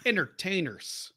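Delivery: mimicking a wrestler, long pause before ENTERTAINERS. Co-host mimicking how the wrestler talks, with a long pause before "Entertainers". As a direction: split off "Entertainers" after a gap and draw it out. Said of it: "He's got that very s-, long pause".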